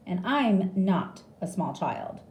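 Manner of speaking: ordinary delivery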